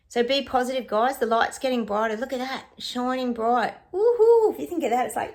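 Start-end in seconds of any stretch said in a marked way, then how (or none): 3.9-4.5 high, loud woohoo; excited whoop. Real, not acted. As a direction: cheer it out joyfully. Celebrating good card.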